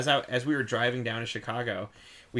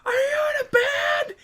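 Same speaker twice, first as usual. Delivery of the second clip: high, loud yell across lanes; shouting over traffic; 6 dB louder than usual. Retold or imitated. Yelling from the car at the van driver on the freeway. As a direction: holler it out the car window. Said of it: "we're screaming back and forth".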